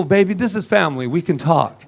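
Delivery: normal talking voice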